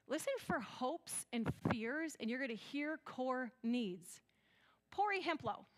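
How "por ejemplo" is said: "Pori Hemplo" in a broad gringo Spanish accent, pitched up; self-mocking American take on Spanish "Pori Hemplo"; West Michigan Spanish. Real, not acted. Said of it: "West Michigan Spanish".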